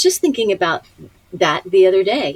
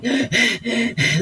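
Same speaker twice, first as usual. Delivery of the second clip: imitated exhausted gasping; 4 semitones higher than usual. Actress on her knees after many takes, imitated gasping. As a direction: gasp for air as if spent. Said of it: "on my knees going".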